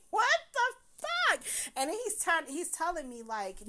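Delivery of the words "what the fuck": high, drawn-out mock outcry; exasperated disbelief. Woman retelling being tackled, exasperated disbelief. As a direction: stretch it out in high-pitched disbelief.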